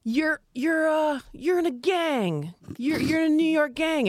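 high, slow teacherly prompt; mock instructor. Improv teacher giving a scene prompt, high and slow. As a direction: drag it out like a bossy improv coach. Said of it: "they would say".

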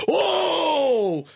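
sudden very high shocked "Oh!"; collective gasp. Retold or imitated. Teammates seeing the wood in his cheek, shocked high cry. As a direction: squeal of shock at what they see. Everybody goes.

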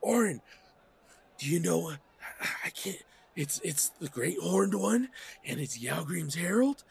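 high, stammering, overwhelmed voice; frantic fan unable to choose; excitable Ragmek. Acted. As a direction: gush helplessly, tripping over words. Game master plays excited Ragmek.

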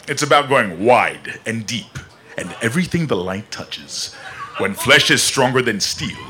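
deep, measured, resonant movie patriarch; James Earl Jones. Shown as a demonstration. Guest does Mufasa for the panel, James Earl Jones impression. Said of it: "Your James Earl Jones impression".

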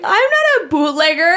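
high-pitched, hammed-up indignation; mock protest. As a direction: protest it as a silly running joke. Acts out their running joke.

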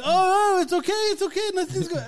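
very high, loud, hurried soothing; frantic reassurance. Retold or imitated. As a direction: act out panicked calming of a screaming kid. Calming a screaming child.

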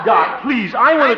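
high, loud pleading interruption; exasperated plea. Acted. Harold trying to interrupt the rambling doctor, exasperated. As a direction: burst in, desperate to be heard.

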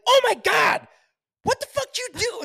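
high, loud mock outburst; mock shout. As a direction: yell it at her in mock horror. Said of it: "I'd be like".

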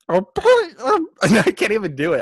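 squawky laughing duck attempt; fail at the duck voice through giggles. Shown as a demonstration. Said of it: "Here we go".

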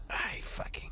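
unvoiced whispered curse. Real, not acted. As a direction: hush it to a whisper. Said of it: "whispered aside".

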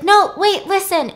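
lightly acted plea, high and insistent; urgent plea. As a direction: mimic someone urgently trying to explain. Caught woman urgently halts her boyfriend.

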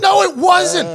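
high, insistent denial; acted-out indignant comeback; defensive contradiction. Acted argument with friends.

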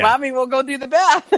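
amused mock-chirpy mom; laughing retold line. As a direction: act out the hand-off with a laugh. Acts out handing off the mess, laughing.

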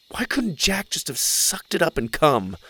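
ordinary delivery, nothing marked

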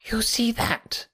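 high, reedy, confiding question; nervous patient. A narrator's reading. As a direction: point it out in a thin, high voice. Nervous patient shows his money box.

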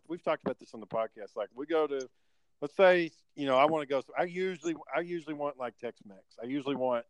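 usual speaking voice

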